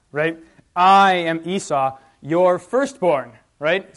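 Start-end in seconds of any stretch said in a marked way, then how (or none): 0.7-3.3 "I" loud and stretched, then "your firstborn" slowly spelled out; emphatic overcompensating. Acted. Jacob trying to fool Isaac, "I am Esau, your firstborn" slow and overemphatic. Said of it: "he's overcompensating".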